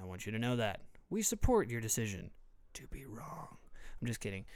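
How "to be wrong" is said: teasing unvoiced whisper; mock whisper. Host teasing listeners who disagree, joking whisper. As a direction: whisper it as a pointed joke.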